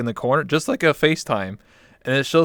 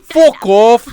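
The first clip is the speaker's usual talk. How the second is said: loud, high mock British yell; hammed-up British shout; British accent; 10 semitones higher, 13 dB louder than usual. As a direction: holler like a rowdy Brit. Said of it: "that's too British".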